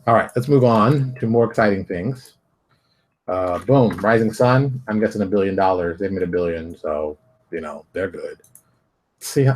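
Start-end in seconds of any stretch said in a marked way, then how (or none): none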